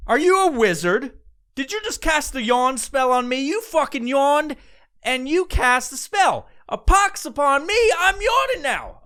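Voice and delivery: high mock-dramatic accusing; mock accusation. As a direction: accuse them like a witch hunter. Mock accusing a yawning wizard.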